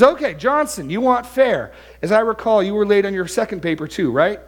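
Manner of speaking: high, loud mock-challenging professor; acted needling cross-examination; professor's comeback. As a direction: call the student out, pouncing on his complaint. Professor turns the complaint on student.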